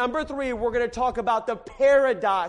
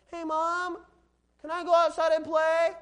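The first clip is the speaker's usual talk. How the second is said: high-pitched imitation of a pleading kid; child asking mom; 5 semitones higher, 4 dB quieter than usual. Kid asks mom to go outside, high child voice. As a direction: put on a high little-kid voice. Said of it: "heard a mom get sarcastic with their kids".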